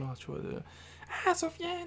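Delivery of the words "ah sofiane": high shrill-free cagole call, way up. Retold or imitated. Jules calling him from afar in a high cagole voice. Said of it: "j'entends une voix de, de cagole".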